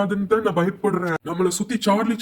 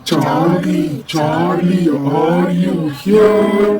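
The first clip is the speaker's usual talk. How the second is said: long drawn-out eerie call; spooky summoning voice; 3 semitones lower, 4 dB louder, much slower than usual. Acted. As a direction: call the spirit slowly and hauntingly. Summoning Charlie at séance.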